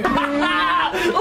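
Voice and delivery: cackling out loud, high; big delighted laugh; real loud laugh; loud burst of laughter. Real, not acted. Laughing at the clip.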